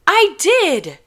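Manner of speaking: high, loud, defensive cry; high, loud I did; indignant protest. Acted. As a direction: insist loudly. Juliet protesting loudly.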